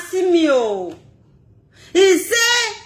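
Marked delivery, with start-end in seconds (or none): 1.9-2.7 shout. Real, not acted. Shouting mid-rant.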